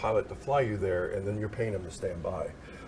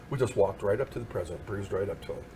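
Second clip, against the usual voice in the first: normal talking voice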